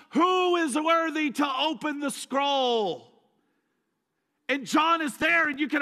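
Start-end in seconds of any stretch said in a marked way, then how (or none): 0.1-3.1 slow, loud proclaiming shout; heavenly call. Retold or imitated. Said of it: "someone goes".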